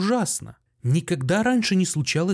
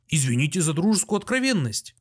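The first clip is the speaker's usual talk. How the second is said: ordinary delivery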